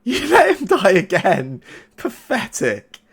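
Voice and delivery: high, loud sneering villain; taunting voice. Acted. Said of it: "that voice comes through again".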